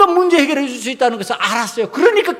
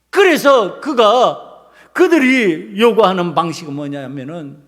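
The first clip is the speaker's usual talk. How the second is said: usual speaking voice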